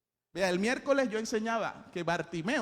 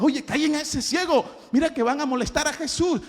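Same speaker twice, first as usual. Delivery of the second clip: annoyed crowd; 8 semitones higher, 8 dB louder than usual. Acted. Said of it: "Y la gente le decía".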